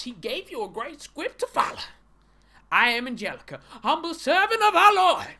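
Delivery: loud, high, hammed-up sermon; mock preacher. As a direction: preach it out, soaring on the big words.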